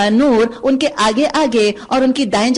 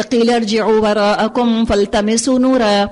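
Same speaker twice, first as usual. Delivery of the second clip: slow chanted Arabic recitation of the verse; slow Quranic recitation set apart from the talk. Acted. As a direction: recite it in measured tajweed, slowly.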